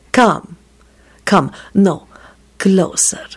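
spaced silky commands, sinking to a whisper. A narrator's reading. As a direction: coax him closer, ending whispered. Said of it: "Radu said silkily to the goblin".

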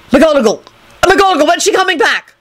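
high, urgent shouted question; frantic. Acted. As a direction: demand it in a panic, pitched high. Lupin desperately yelling to Neville.

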